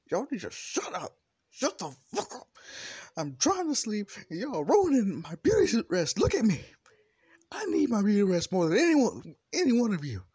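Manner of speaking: hammed-up sleepy teacher complaining; Aizawa voiced. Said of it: "then Aizawa just trying to say".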